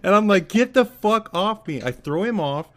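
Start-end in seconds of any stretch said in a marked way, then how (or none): 0.5-1.8 high, loud mock outrage; reenacted indignant yelling; exasperated retold yell. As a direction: shout it like throwing off an attacker. Acting out fighting off zombie again.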